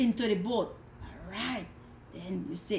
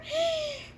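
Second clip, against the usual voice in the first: sharp high gasp of alarm; storytelling gasp; dramatic gasp. Acted. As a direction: gasp for the little listeners. Peter walking on water.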